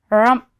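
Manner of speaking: short, loud bear grunt; sleepy bear's goodbye grunt. Acted. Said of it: "he growled".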